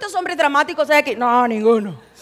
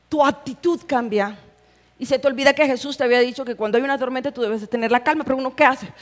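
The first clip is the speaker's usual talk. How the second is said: ordinary talk, nothing marked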